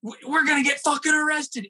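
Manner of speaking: high panicked cry; panicked yell. Retold or imitated. Driver panicking over the pipe, high yell. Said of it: "just screaming to himself".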